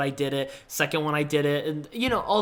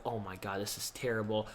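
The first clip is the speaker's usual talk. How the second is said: very soft, low, deflated voice; retold dejection; 7 semitones lower, 12 dB quieter than usual. Rejected student reacting to bad news, soft low retold dejection. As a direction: drop low and quiet, defeated. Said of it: "So that really depressed me".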